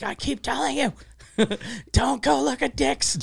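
high scolding mom voice, laughing through it; mom yelling. Retold or imitated. Mother scolding her teenage daughter, high laughing scold. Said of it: "her mom started yelling at her".

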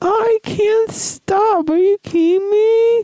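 very high, loud comic squeal; squealing rant. Acted. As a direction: squeak it out hysterically. Squealing catchphrase.

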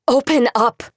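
loud, high shout; furious shout at the door. Acted. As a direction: yell it through the door. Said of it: "she shouted".

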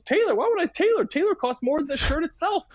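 high, indignant mock voice of a cheapskate; mocking Curbs. Curbs refusing to pay for tailoring, high incredulous mock voice. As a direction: play him baffled at the idea of tailoring, high up. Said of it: "He goes".

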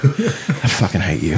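slow, laughing fake fury; laughing mock anger. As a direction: hate you through laughter. Teased friend snaps back.

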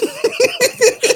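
squealing high laugh; high laughing fit. Real, not acted. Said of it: "Why are you laughing so hard?".

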